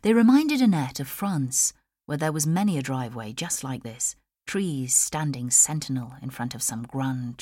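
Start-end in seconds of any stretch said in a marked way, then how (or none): none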